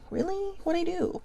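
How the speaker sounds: hurt dog voice. Retold or imitated. Poor dog sitting there going.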